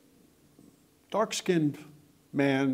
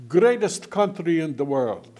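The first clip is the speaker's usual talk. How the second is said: loud, higher, slow emphatic boast; retold proud declaration; 4 semitones higher, 8 dB louder than usual. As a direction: proclaim it like a proud immigrant, imitated. Retelling an immigrant's proud declaration.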